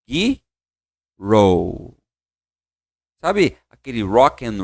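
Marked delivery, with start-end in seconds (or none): 0.1-1.9 put-on Girl said lower and drawn out, gur-ro; pronunciation demo. Shown as a demonstration. Teacher has class repeat Girl, drawn-out model. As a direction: stretch Girl with a rounded R. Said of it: "Repete comigo, ó".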